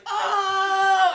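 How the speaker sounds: very high, shouted "oh"; imitated crowd roar. Storyteller imitating party's reaction to the insult, shouted roar. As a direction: explode like a stunned crowd. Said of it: "everyone's like".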